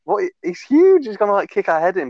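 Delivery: high, urgent panicked plea to a mate; panicked warning. Retold or imitated. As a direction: hiss a frantic warning, voice jumping up high. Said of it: "I was freaking out".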